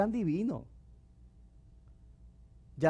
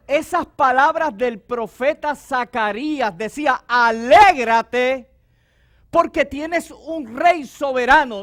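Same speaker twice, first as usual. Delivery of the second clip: loud, higher, slow shouted sermon; fiery preacher shouting to the congregation; full-force preaching; 6 semitones higher, 12 dB louder, slower than usual. Acted. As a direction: preach it out to the church.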